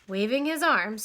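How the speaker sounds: ordinary delivery, nothing marked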